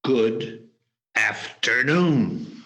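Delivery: drawn-out, emphatic goodbye; slow, weighty dismissal; firm dismissal. Acted. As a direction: stretch each word to send them away. Dismissing nephew who keeps pleading.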